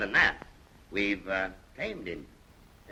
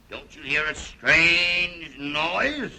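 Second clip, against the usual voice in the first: very slow, raised, stretched-out question; theatrical rehearsal; 4 semitones higher, much slower than usual. Pretend. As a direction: draw every word out dramatically as a stage cue. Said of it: "we'd better rehearse that again".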